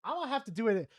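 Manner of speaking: usual speaking voice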